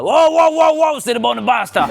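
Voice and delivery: very high, blustering mock-Italian outburst; insistent grandfather. Grandfather overruling his grandson about pasta, high blustering protest.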